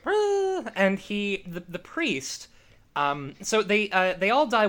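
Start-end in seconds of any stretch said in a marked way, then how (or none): 0.1-0.7 a sudden high-pitched little "Ah"; high mock gasp. Host voices the flopping moment as high startled yelp. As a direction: yelp like something just popped out. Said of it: "Just go".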